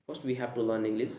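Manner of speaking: ordinary talk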